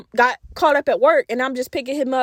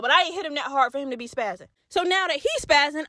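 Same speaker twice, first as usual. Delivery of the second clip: usual speaking voice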